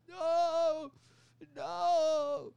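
very high, loud anguished wail; mock crying no. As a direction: wail it like a cartoon hero. Hero crying out, 'he's crying'.

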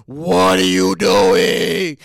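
very low, drawn-out belly groan; complaining stomach. Acted. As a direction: rumble slowly as an angry stomach. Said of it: "But your stomach like".

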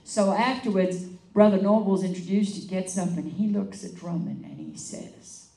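ordinary talk

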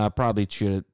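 ordinary delivery

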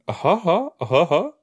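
a deadpan fake chuckle; sarcastic fake laugh. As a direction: pretend to laugh at a bad joke, pointedly unamused. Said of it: "I'm laughing".

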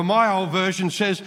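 normal talking voice

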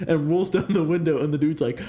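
ordinary talk, nothing marked

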